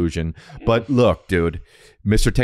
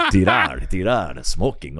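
put-on Japanese-accented teacher; 3 semitones lower, slower than usual. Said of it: "from Japan, he's thick accent".

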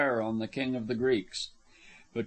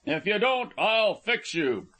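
high-pitched warning, slowing at the end; called-out threat; 6 semitones higher than usual. A narrator's reading. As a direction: threaten them from a distance. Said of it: "he called out suddenly".

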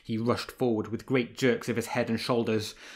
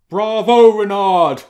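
high, full-voiced shout of bravo; 10 semitones higher, 16 dB louder, slower than usual. A narrator's reading. Lanfert cheering on the fleeing fox, loud high shout. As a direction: cheer him on at full volume. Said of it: "shouted Lanfert".

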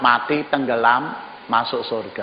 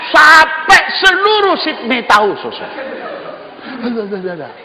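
exaggerated outburst; 8 semitones higher, 5 dB louder, slower than usual. Real, not acted. Gossip spreading, mocking exaggeration.

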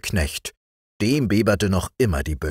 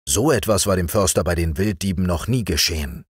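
ordinary delivery

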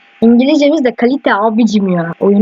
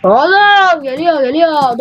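scared; 6 semitones higher, much slower than usual. Real, not acted. Monster approaching in horror game.

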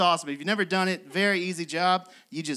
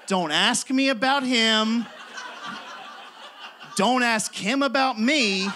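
higher, slow, emphatic mock announcement; hammed-up warning to onlookers, pitched up; mock public announcement; 4 semitones higher, slower than usual. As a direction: address the bystanders slowly and firmly. Acted calling out to park crowd.

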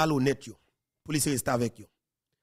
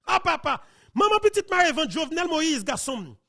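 much higher, louder cry of disbelief; dismayed exclamation; 12 semitones higher, 5 dB louder than usual. Real, not acted. Host reacting to the arrest news, crying out in disbelief. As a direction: cry out, slowing on the shocking part.

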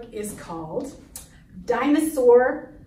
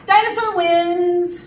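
loud, raised victory call; triumphant announcement; 5 semitones higher, 6 dB louder than usual. Acted. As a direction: proclaim the winner proudly. Proclaims the dinosaur's victory.

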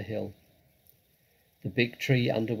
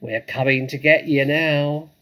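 loud, menacing holler; yelled; 4 semitones higher, 10 dB louder than usual. A narrator's reading. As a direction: yell it as a threat, pitched up. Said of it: "yelled Bunce".

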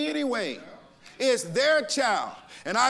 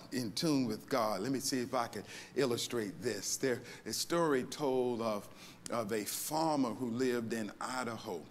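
much softer, lower storytelling; quiet closing; 10 semitones lower, 8 dB quieter than usual. Real, not acted. Pastor winding down the sermon, softer and lower for a story. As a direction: bring it down to a soft, low story.

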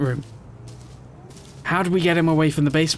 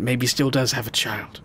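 ordinary talk, nothing marked

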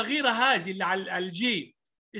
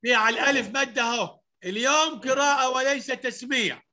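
usual speaking voice

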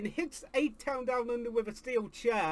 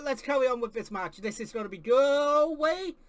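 usual speaking voice

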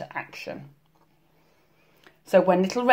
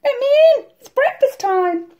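high, loud squeaky wolf in disguise; piping falsetto; squeaked; 6 dB louder than usual. A narrator's reading. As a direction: squeak it like a fake little girl. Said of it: "squeaked the wolf".

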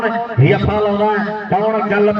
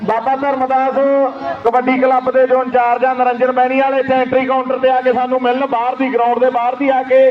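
PA announcement; 4 semitones higher than usual. Real, not acted. Public address announcement to organiser.

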